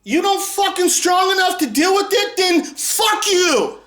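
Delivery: high, outraged shouting; incredulous furious yell. Real, not acted. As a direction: blow up in disbelief. Raging reply to a critic.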